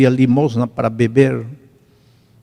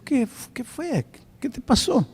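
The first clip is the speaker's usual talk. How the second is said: tender worried questions to a crying man; gentle concern; 11 semitones higher, 6 dB quieter than usual. Retold or imitated. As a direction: coax him softly to tell you what is wrong. Pastor gently asks the weeping man.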